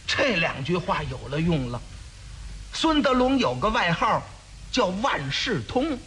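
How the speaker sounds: ordinary talk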